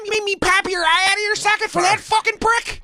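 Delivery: high-pitched enraged mobster imitation; movie tough-guy impression. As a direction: rant it like a squealing gangster. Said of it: "even when he goes".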